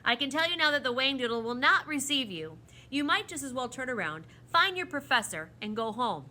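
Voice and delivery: loud, businesslike dismissal; brisk. A narrator's reading. The proc ends the children's journey, brisk and firm. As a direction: send them off without discussion. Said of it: "he said briskly".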